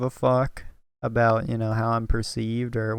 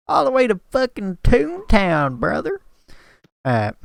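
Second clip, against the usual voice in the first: southern accent; 6 semitones higher than usual. Shown as a demonstration. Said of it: "but I could really get down with it".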